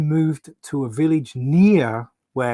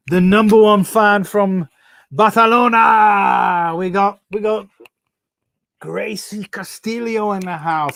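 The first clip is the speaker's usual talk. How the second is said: loud, higher arena-announcer call; hype announcement; 6 semitones higher, 5 dB louder than usual. Real, not acted. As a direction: shout the fan's name like a ring announcer. Hyping a fan on live stream.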